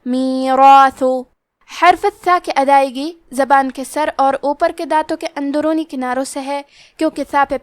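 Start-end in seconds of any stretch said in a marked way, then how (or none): 0.1-1.2 "میراثو" with a heavy ra and a heavy long alif, slow. Shown as a demonstration. Teacher shows the heavy ra and alif, slow. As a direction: give the ra and the alif full weight. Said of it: "مد الف کو بھی بھاری آواز میں پڑھنا ہے".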